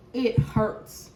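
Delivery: usual speaking voice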